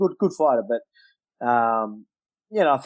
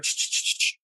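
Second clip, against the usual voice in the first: hissed spray noise, softer; spray sound; 5 dB quieter than usual. Retold or imitated. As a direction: imitate a spray bottle with voiceless bursts. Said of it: "Just went".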